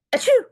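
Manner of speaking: high, loud hammed-up achoo; big theatrical sneeze. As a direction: blast out a cartoon sneeze. Gerald sneezes.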